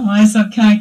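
usual speaking voice

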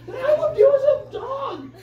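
high, laughing, almost crying; laughing outburst. Retold or imitated. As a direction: blurt it out through laughter. Dog-man confesses love.

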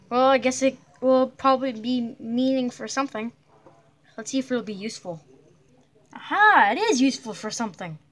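ordinary talk

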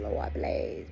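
whispered, begging please; mock whispered plea. Sinner mocked for begging God, whispered plea.

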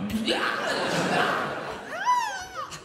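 sudden shrieking laugh; high squealing laugh. Real, not acted. Big laugh.

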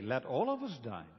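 ordinary delivery

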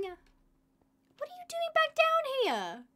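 put-on high, slow, sing-song baby talk to a turtle; cutesy pet voice. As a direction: coo at the little turtle like a pet. Talking sweetly to a turtle.

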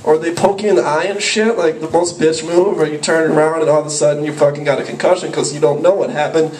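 usual speaking voice

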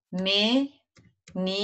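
"menina" slowly split as me, ni, na; syllable by syllable. Shown as a demonstration. Teacher announces the first word to pupils, syllable by syllable. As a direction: say "menina" with a clear gap after each syllable. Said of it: "Então, vamos fazer".

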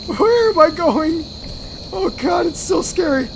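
high, drawn-out frightened wail; scared. Real, not acted. Player fleeing and lost, scared.

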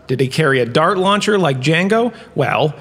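ordinary talk

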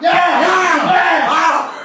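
high, loud hyped-up announcer yelling; Dan Cook impression. Mimicking Dan Cook hyping up the next act, high and loud. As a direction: hype the crowd like a rowdy emcee, way up high. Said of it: "I think I'll do it like Dan Cook".

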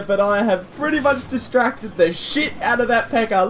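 normal talking voice

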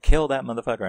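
quick burst of crowd outrage; imitated crowd yell. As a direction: yell it like a riled-up moviegoer. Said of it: "they were screaming".

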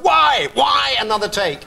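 sharp high shout of protest; erupt, fed up after seven takes; exasperated outburst. Retold or imitated. Retelling a film shoot, a high exasperated shout at the crew. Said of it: "I said".

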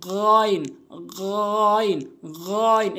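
"গইন" as Arabic ghayn from the top of the throat; গইন demonstration. Shown as a demonstration. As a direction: drag the throat friction in "গইন". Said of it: "হলকের শেষ থেকে আমরা উচ্চারণ করবো". ("গইন", হলকের শেষ থেকে)

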